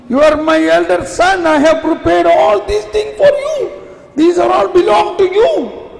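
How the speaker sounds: high, emphatic acted remonstrance; indignant father. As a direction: plead and scold as an outraged father. Father scolds departing son, acted.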